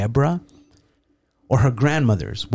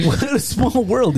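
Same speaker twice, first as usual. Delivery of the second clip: laughing amazement; 12 semitones higher than usual. Real, not acted. Laughing at coincidence.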